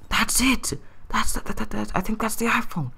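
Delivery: high, stammering thrill at finding it; excited discovery. Retold or imitated. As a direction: pitch jumps up, tripping over that. Spotting the lost phone underwater.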